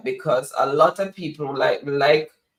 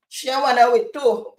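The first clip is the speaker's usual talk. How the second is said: high, louder Jamaican patois call; 8 semitones higher, 5 dB louder than usual. Retold or imitated. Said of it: "he just loved the Jamaican accent".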